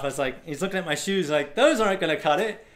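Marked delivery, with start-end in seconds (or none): 1.5-2.6 higher, louder retold warning; dismissive driver. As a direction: wave off the useless shoes emphatically.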